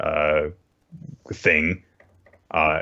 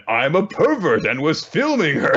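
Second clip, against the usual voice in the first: high, hammed-up creep owning up; mock confessing pervert; 10 semitones higher, 4 dB louder than usual. Caught pervert giving a more believable story, hammed-up confession. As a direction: blurt it out in a put-on high voice. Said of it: "more believable story would have been".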